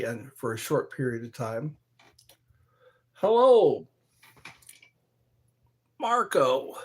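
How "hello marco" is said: high, loud hello; excited hello. Real, not acted. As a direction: sing out a welcome. Greeting new viewer.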